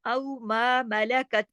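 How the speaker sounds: slow recited औ, sounded au as in Lucknow; soft leen diphthong. Shown as a demonstration. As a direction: glide a to u in औ, then long मा. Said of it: "इसकी आवाज उर्दू के लफ्ज लखनऊ से मिलेगी". (औ को लखनऊ जैसा पढ़ते हुए)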